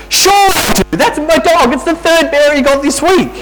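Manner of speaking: put-on high, bright child's voice, proud and sure; little boy voice. Boy boasts to the principal about his dog, high child voice. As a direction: play the cheeky kid topping the lie.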